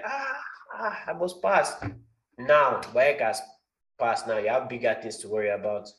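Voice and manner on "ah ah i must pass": "Ah, ah" yelped much higher; mock exam panic; panicked student. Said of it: "you're like".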